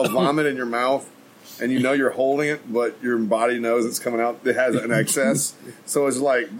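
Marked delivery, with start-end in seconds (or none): none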